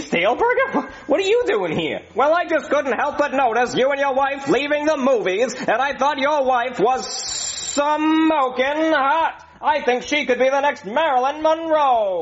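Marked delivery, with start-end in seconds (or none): 2.2-12.2 high, smooth-talking showbiz agent; oily Hollywood agent pitch; schmoozy hollywood agent. Acted. Agent flatters wife.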